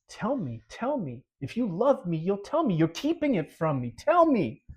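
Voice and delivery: high, insistent coaxing; acted-out pestering that climbs at the end; wheedling nagging. Acted lover nagging for the secret.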